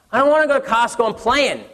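put-on high childish protest; whiny little boy. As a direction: pout like a kid who won't stop playing. Son refuses, voiced by parent.

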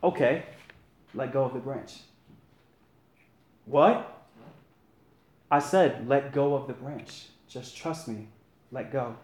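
a hushed divine voice, unhurried; voice of God. A narrator's reading. God calls down to Jack on the cliff, quiet and calm.

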